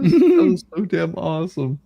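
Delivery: excited. Real, not acted. Delighted after the beast reading.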